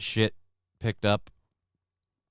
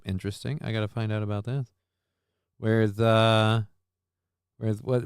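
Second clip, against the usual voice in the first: ordinary delivery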